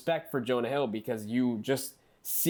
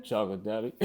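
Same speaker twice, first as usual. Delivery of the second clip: lower, put-on film character voice; quoted character reply; 5 semitones lower, faster than usual. Cohost acting out the signing's name, put-on character reply. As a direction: drop lower to deliver the quoted answer. Acts out the film's answer.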